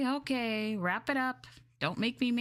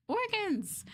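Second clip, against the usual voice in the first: excited exclaim; 12 semitones higher than usual. Acted. Triumphant announcement.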